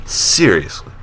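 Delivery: ordinary talk, nothing marked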